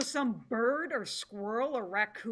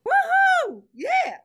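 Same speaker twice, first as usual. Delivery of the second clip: high, loud whooping cheer; wild cheering; 11 dB louder, slower than usual. Acted. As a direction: whoop with delight. Cheering at the new laws.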